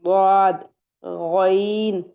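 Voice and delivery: "गौद, गईन" held long and rounded, one at a time; heavy letter demo. Shown as a demonstration. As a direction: draw out "गौद" and "गईन" slowly. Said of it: "पूरी टंग उठाएंगे और ऊपर को लगाएंगे".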